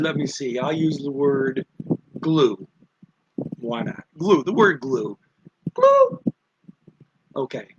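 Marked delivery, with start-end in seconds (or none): 5.7-6.2 exaggerated high tone. Shown as a demonstration. Teacher demonstrating Mandarin tone one on "Glue", high tone. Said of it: "at the top of your voice".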